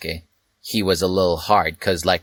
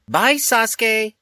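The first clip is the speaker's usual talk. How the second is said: sing-song goodbye; slower than usual. Acted.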